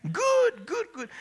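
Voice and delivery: very high, loud whooping repetition; piercingly high exultant yells; ecstatic mother's cries. Retold or imitated. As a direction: imitate mom overcome and shouting for joy. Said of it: "this is what she says".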